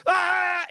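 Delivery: high-pitched mock shriek; imitated scared scream. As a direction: scream like an unprepared horror viewer. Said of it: "and they're like".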